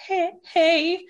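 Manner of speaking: high, tearful greeting; retold tearfulness. Crying caller saying hello to mom, retold tearful voice. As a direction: act out greeting someone while trying not to cry. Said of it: "that I'm crying".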